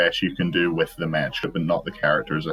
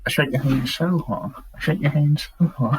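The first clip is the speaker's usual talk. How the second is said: pitched-up silly catchphrase delivery; higher, hammed-up repeat of the line; quoted catchphrase voice; 7 semitones higher than usual. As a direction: chant it like a goofy bit. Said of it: "I saw the opportunity to include".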